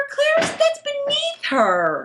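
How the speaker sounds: high, drawn-out mock dismay; mock indignation. Fan scolds Claire's behaviour to co-host, high mock indignation. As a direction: protest slowly, way up high.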